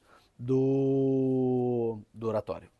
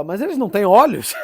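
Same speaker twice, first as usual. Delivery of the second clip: loud, higher exasperated question breaking into a laugh; São João, choleric; 6 semitones higher, 8 dB louder than usual. Retold or imitated. Saint snaps back at the former pupils, comically choleric. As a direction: act out a hot-tempered retort, amused by it. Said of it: "O São João era bem colérico".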